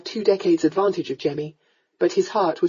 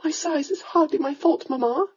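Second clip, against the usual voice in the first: touch of impatience; 9 semitones higher than usual. A narrator's reading. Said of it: "with a touch of impatience".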